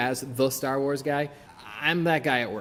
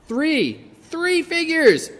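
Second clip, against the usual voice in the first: much higher and louder, stretched out slowly; thrilled; 12 semitones higher, 7 dB louder, much slower than usual. Real, not acted. Collector counting his new figures, giddy and drawn out. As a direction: savour the count with delight.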